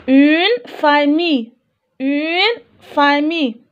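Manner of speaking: une famille stretched slowly, syllable by syllable; slow model reading. Shown as a demonstration. Teacher modelling une famille for the class, slow and deliberate. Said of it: "teacher models reading for class".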